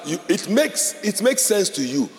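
usual speaking voice

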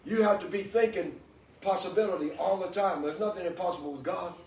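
high, loud preaching at full force; fervent preaching. Acted. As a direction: preach it out to the congregation, building fervor. Preaching the helmet of salvation.

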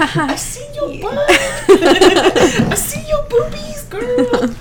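very high, slow sassy drawl; sassy neighbor voice. Retold or imitated. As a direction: stretch it out, high and cheeky. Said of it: "the neighbors were like".

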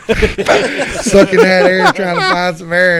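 loud, high words through real laughter; laughing hard. Real, not acted. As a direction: barely get it out for laughing. Laughing at own story.

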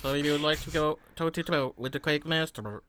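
flat, even synthesized speech voice; robot voice. Retold or imitated. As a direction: speak it like a text-to-speech machine. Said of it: "Your robot voice is better than mine".

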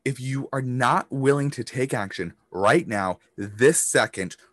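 slow and deliberate, leaning on not; slow emphatic repeat. Shown as a demonstration. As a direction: say it again so it sinks in. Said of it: "I'm gonna say that again".

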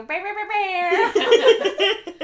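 high, loud, long-drawn mock noise; exaggerated mimicry of a co-host, laughing; imitating the halfway-point voice. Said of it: "you go".

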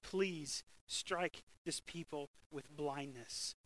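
soft, slow prayer; hushed prayer. Acted. Elisha to the Lord, slow soft prayer. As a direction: pray it slowly and softly.

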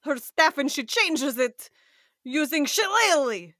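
slow, badly faked Eastern European game character; horrible Eastern European accent. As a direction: narrate your move slowly in a hammy Eastern European accent. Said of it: "people are laughing at my horrible accent".

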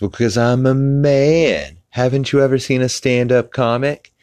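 hammed-up cartoon husband, stretching "man"; Peter. Peter explaining to Lois why he won't ask, high mock-indignant voice. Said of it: "and he says".

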